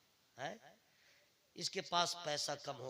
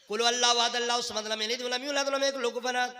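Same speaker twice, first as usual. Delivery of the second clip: gabbled fast recitation; rushed recitation; 5 semitones higher, 14 dB louder than usual. Retold or imitated. Preacher imitates rushed reciters, rattling off the verse. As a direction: rattle it off like the careless fast readers. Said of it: "fast कैसे पढ़ेगा".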